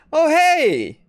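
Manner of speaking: high, loud cheery shopkeeper hello; chipper greeting. Acted. Shopkeeper greeting newcomers, bright and high. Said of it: "she says".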